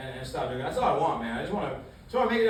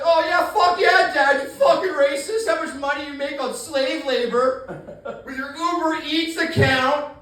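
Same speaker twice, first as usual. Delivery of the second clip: put-on bratty teen; 6 semitones higher, 4 dB louder than usual. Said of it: "imagine if I was his father".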